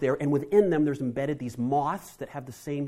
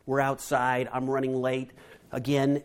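ordinary delivery

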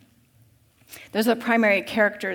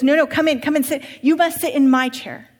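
higher, louder, insistent rich master; urgent master; 4 semitones higher, 6 dB louder than usual. Acted. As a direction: hurry him inside, pleading and pressing. Master voiced again.

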